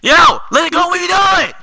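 loud, high-pitched protest; exasperated yell. Real, not acted. Stuck player to the one blocking, exasperated yell.

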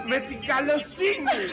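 high, slow and laughing. Acted. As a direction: savour the word καλοσύνη with glee. Repeats phrase, rising to shout.

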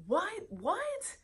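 high, soft squeak of disbelief, rising sharply; disbelieving. Retold or imitated. Woman recalling she couldn't believe it happened, disbelieving. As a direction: retell a stunned reaction, pitch shooting up quietly. Retelling her own shocked disbelief.

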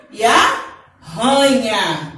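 arranha stretched very slowly with a guttural rr; strong double R. Shown as a demonstration. Teacher letting children hear the strong R. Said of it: "Olha o som como fica forte".

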